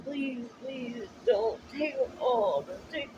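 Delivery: high, pleading mock sob; retold tearful plea. Begging her father not to take the cats, tearful plea.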